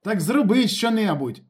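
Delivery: high, loud, slow urging; loud exasperated demand; exasperated shout. A narrator's reading. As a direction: yell impatiently at the driver. Tense man snaps back at driver.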